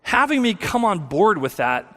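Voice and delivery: usual speaking voice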